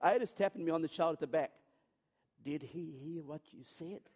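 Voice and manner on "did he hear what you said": very hushed, low imitation of wife; furtive aside. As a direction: ask it secretly, barely voiced. Wife's furtive question from back seat.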